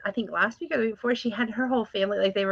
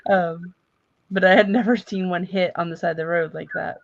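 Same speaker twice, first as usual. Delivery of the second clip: normal talking voice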